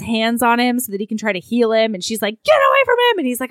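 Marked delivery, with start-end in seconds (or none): 2.4-3.2 high, loud mock-desperate cry; acted frantic yell. Heroine driving a healer from her dead love, frantic yell. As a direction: yell as the grieving heroine would.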